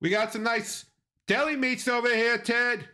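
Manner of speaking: high-pitched Boston deli heckler; Boston accent. Acted. People busting Ted's chops, mock Boston accent. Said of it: "doing that accent".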